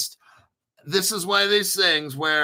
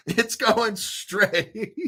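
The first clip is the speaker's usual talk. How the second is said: laughing; 6 semitones higher, much slower than usual. Real, not acted. Laughing at a rising crypto chart.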